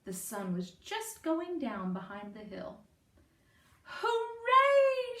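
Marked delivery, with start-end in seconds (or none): 3.8-5.2 high, loud cheer; cheering shout. A narrator's reading. Townspeople cheering the finished cellar, loud hooray. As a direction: cheer like a crowd. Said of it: "shouted the people".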